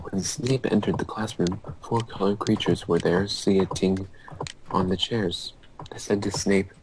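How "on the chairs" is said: normal talking voice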